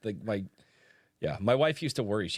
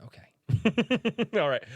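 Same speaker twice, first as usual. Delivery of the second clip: usual speaking voice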